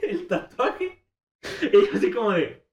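laughing through the words. Real, not acted. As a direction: break into laughter mid-phrase.

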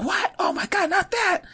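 high-pitched imitation of a shocked mom; mock-horrified mom gasping. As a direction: squeal it out like a horrified mother. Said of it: "she's like".

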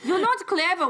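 high, hammed-up put-down; mock bickering. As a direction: jeer it in a high playful voice. Mimics the bickering Grimm brothers.

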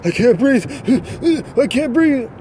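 high whiny put-on pain with little yelps; mock fake suffering. Suspect faking pain for body cam, high mock whine. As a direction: ham up a suspect faking injury for the camera. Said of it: "purposely say these things like".

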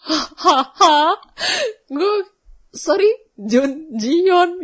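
loud, high, slow incredulous gasp; can't believe what dad just said; shocked disbelief. Retold or imitated. A daughter stunned by her dad naming a K-drama star, loud high disbelief. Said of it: "Gue sampai kayak".

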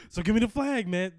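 demanding. Retold or imitated. Demanding customer, loud.

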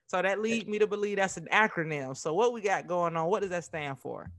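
normal talking voice

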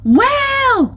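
high, loud call to halt the whale; shouted. A narrator's reading. Crystal confronts the greedy whale, shouting. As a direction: bellow it high to grab his attention. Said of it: "Crystal shouted".

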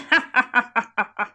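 mock evil laugh; hammed-up villain cackle; evil chuckle. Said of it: "Rire diabolique".

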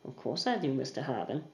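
icy and polite, barely voiced; terse smile. Charlotte answering Mister Harbin with a terse smile. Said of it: "terse smile".

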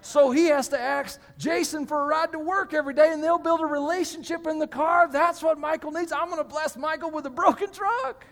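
high, loud, building excitement; gleeful scheming. Acted. God revealing a plan for Michael, acted with mounting glee. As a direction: rattle off the plan with rising delight.

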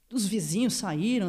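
usual speaking voice

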